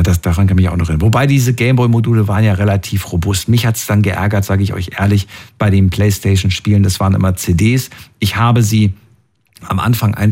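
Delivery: ordinary talk, nothing marked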